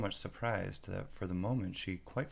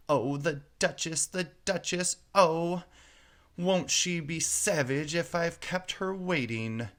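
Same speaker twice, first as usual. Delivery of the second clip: high, louder panicky fretting; 7 semitones higher, 4 dB louder than usual. A narrator's reading. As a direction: dread keeping the Duchess waiting. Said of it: "muttering to himself".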